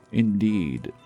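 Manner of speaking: usual speaking voice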